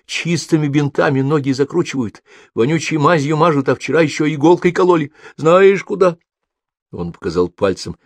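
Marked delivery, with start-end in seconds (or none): 0.1-6.2 put-on high child's voice, aggrieved; complaining little boy. A narrator's reading. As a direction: pour out hospital woes to a friend. (жалобный детский голос)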